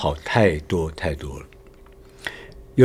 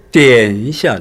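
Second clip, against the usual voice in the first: 点 dragged out long; stretched 点; 2 semitones higher, 4 dB louder, much slower than usual. Shown as a demonstration. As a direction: hold 点 far too long before 一下. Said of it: "那个拉得很长".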